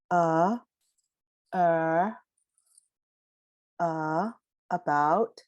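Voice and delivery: a, er, uh, about, each vowel sounded in turn; central vowels. Shown as a demonstration. Teacher showing the central vowels: a, er, uh. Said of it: "more in the central part".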